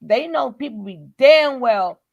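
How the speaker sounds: ordinary delivery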